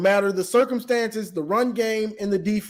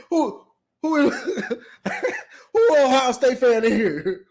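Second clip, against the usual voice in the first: calling out through laughter; laughing call-out; 5 semitones higher than usual. Retold or imitated. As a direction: laugh while calling the room.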